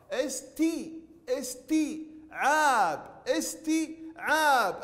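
very slow استي, pause, then a long عاب; saturated long ii. Shown as a demonstration. Teacher repeating the taa for pupils, syllables drawn out. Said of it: "نعيد نطق التاء ونشبع التاء بالكسرة".

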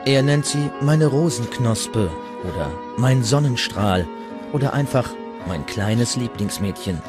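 ordinary talk

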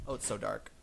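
ordinary talk

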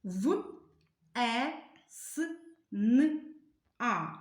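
В, е, с, н, а said one sound at a time with pauses; sounding out each letter. Shown as a demonstration. Teacher to pupils, sounding out весна slowly. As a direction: isolate every sound of весна for the class. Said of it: "вимовимо всі звуки у слові весна".

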